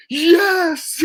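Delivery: high, drawn-out imitated shout; mock ecstatic yell; audience member's cheer. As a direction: whoop like one overexcited fan in the crowd. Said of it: "you hear one dude, one dude in the audience".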